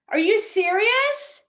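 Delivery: high, rising, outraged disbelief; incredulous. Real, not acted. Learning that someone pretended to be her, incredulous. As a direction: pitch it up into a stunned question.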